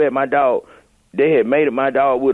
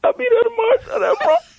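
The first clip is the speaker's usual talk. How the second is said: high, teary mock sob; fake tearful promise. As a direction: promise it while pretending to choke up. Prank caller still fake crying.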